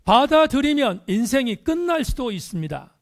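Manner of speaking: "받아들이면" and "끝날" thrown high and loud; emphatic warning. Real, not acted. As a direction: preach it forcefully, punching "끝날". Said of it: "다시 강조합니다".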